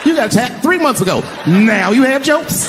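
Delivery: hammed-up outrage, drawn out at the end; mock furious inner rant. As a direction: vent like your spotlight was stolen. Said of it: "I'm thinking in my mind".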